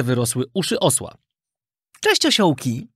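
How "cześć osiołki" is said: lightly voiced marmot, pitched higher; marmot calling out. A narrator's reading. Plump marmot entering the donkey boys' room, calling out. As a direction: greet them in a raised animal-character voice. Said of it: "zawołał do nich grubiutki świstak".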